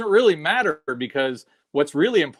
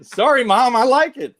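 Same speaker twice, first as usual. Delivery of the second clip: high, loud mock protest; apologetic teenage self; 10 semitones higher, 7 dB louder than usual. Teenager defending the record to mom, high loud protest. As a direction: plead back to a parent, pitched way up.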